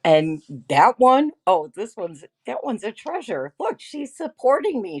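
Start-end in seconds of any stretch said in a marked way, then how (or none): none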